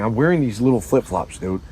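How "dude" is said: ordinary talk, nothing marked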